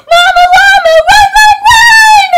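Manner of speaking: very high, loud squeal; frantic shout. Acted. Urging Mama Llama to run, a very high shout. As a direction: scream at her to hurry.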